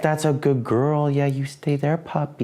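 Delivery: high, cooing praise for the puppy; praising the dog. Real, not acted. Praising the puppy to keep her on her bed, high cooing voice. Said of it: "stop talking to her like that".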